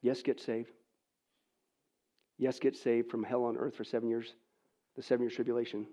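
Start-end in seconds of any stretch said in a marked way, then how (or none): none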